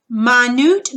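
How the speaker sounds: minute said my-NOOT, end stressed; second-syllable stress. Shown as a demonstration. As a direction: put the weight on NOOT in minute. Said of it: "accented syllable is the second syllable".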